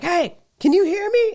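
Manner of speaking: high, loud shout across the table; yelling through a mask. Retold or imitated. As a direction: shout it so he hears you through the plexiglass. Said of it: "and he was like".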